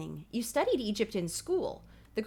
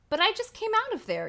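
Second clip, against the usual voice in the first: sputtering protest; 11 semitones higher, 4 dB louder than usual. Acted. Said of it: "you sputter".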